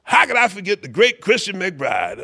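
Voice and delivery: high, hammed-up mock apology; imitated theatrical pleading, pitched high. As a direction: exclaim it like a showman begging forgiveness.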